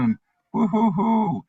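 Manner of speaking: high, drawn-out mocking woo-hoo; sarcastic whoop at career rewards; mock cheer. Mocking the career ladder promise.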